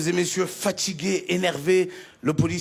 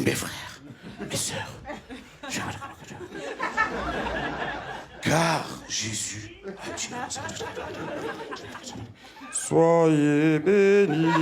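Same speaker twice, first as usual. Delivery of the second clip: soft slow priest's blessing; gentle priest; 2 semitones lower, 6 dB quieter, slower than usual. Retold or imitated. Priest to his congregation, soft slow sermon. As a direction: bless them softly and slowly. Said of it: "tout ce que tu entends".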